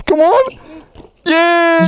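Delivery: high hyped shout; excited yell. Real, not acted. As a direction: yell them on. Cheering a trick.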